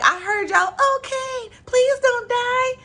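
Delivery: high mock plea from Kane. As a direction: imitate Kane begging lightly. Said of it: "And Kane was like".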